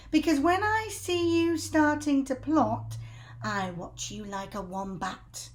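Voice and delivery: high, loud hag voice; Missus Twit voice. Acted. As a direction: menace him like a crone. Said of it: "Missus Twit said".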